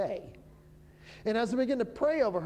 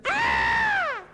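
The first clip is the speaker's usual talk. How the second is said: piercing high shriek, much louder; retold shriek; 8 dB louder than usual. Preacher retells the woman's loud shriek at the word. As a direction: imitate her sudden loud shriek. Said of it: "she went".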